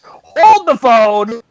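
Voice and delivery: slow, hammed-up cry of surprise; mock shock. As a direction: stop everything in exaggerated disbelief. Acted shock at match order.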